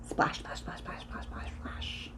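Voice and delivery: rapid whispered splashes; hushed, frantic oar strokes; fast splashes. A narrator's reading. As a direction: rattle the splashes off under your breath. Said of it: "Tina rowed fast".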